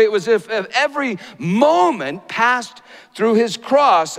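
high, loud impassioned preaching; preaching at full force. Acted. Preacher building to a climax before the congregation, impassioned. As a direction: lift the room with a soaring sermon voice.

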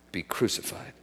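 whispered, barely voiced; hushed whisper. Real, not acted. Preacher whispering the crucifixion to the church. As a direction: let it fall to a whisper.

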